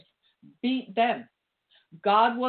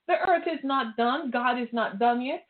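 ordinary delivery

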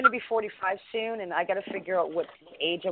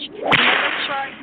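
loud scolding; 5 semitones higher, 11 dB louder, slower than usual. Real, not acted. Scolding about asking a woman's age.

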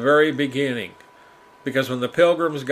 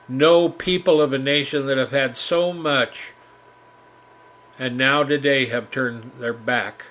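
ordinary talk, nothing marked

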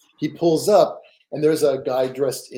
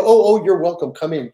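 louder, eager greeting of a welcomed guest; eager welcome; 3 semitones higher, 8 dB louder than usual. Retold or imitated. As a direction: gush out a warm welcome at the door. Temple staff welcoming her.

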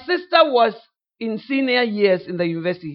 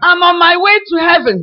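higher and louder, a hammed-up proud proclamation; mock preacher's boast; 7 semitones higher, 8 dB louder than usual. Preacher imitated boasting to a new sister, loud and high. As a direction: shout it like an over-zealous preacher. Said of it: "he started to say".

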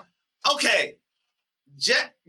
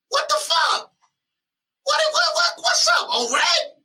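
shocked. Real, not acted. Exasperated outburst at O-Red.

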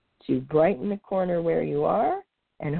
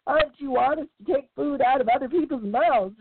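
higher, haughty comeback; retort; 6 semitones higher than usual. Acted. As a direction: snap back with pride. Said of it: "retorted Longlegs".

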